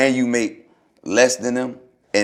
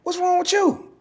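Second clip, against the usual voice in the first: high-pitched mock outburst, much higher; mock flip-out. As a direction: squeal it like a hothead losing it. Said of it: "I'ma flip out".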